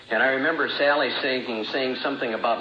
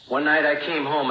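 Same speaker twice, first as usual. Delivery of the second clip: ordinary talk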